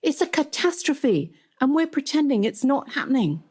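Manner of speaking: high-pitched, impassioned protest, acted; acted exasperated appeal; urgent protest. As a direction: plead the cause with alarm. Zandy arguing urgently with boss.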